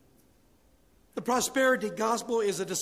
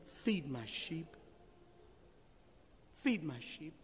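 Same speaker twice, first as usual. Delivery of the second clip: slow, much softer tender charge; soft command; 3 semitones lower, 11 dB quieter, slower than usual. Acted. Christ gently charging Peter to tend his flock, soft and slow. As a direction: say it like a gentle final instruction.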